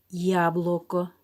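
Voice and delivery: dictating. Shown as a demonstration. Dictation word, slowed.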